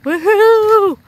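high, loud joyful whoop; excited whoop. Real, not acted. Vlogger at the fair whoops at new rides. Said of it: "like a little big kid in a candy store".

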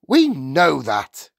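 impatient, heavily stretched retort; ratty. A narrator's reading. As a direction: drag it out crossly. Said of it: "ratty about missing her programme".

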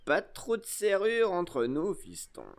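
lightly voiced wheedling old sea-dog; sly pirate. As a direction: tell the boy off with false friendliness. Pirate sends boy out.

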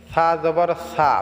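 সা as a thin lisped "tha"; tongue-tip "th" at the start of each সা; careful, light "thaa" for সা; thin pronunciation. Shown as a demonstration. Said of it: "পাতলা হবে".

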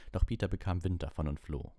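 plain reading voice

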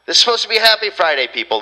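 high, fast, exasperated cry; annoyed outburst. Real, not acted. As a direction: vent the frustration at the crowd. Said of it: "I'm just getting annoyed now".

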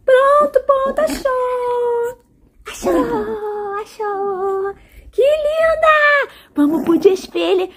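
high cooing pet voice. Real, not acted. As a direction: coo at the dog, drawn out. Cooing at dog.

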